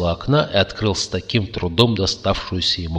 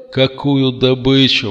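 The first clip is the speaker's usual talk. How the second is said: higher, louder baffled cry; exclaiming; 5 semitones higher, 6 dB louder than usual. A narrator's reading. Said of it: "воскликнул Кертис". (удивлённый возглас)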